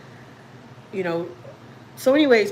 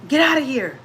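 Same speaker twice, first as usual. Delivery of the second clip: high, louder mock shout at an intruder; retold yell; 5 semitones higher, 4 dB louder than usual. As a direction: order the stranger out, raising the voice. Husband yells at ghost lady.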